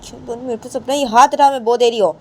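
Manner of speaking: mock furious friend; angry warning. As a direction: warn her off sharply. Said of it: "तो रामा जी".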